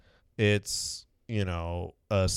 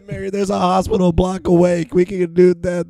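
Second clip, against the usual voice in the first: high, loud pleading voice; mock pleading Mary; 8 semitones higher, 6 dB louder than usual. Mary pleads with Joseph, in a high hammed-up voice. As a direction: beg for the hospital in a hammed-up woman's voice.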